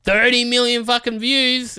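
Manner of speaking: hammed-up high voice of an AI sorting videos; mock AI picker. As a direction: play a greedy algorithm getting excited over views. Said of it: "And the A I goes".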